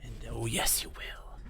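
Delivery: menacing hushed whisper. Acted. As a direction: breathe the threat out softly. Ominous whispered threat to headset.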